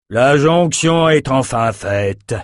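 slow, high triumphant exclamation; king's exclamation. Acted. Raider king greeting his ally, slow exclaiming. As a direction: hail the meeting proudly. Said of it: "s'écria le roi".

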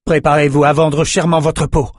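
rally them for a hard fight; called-out warning. Acted. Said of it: "lança Holden".

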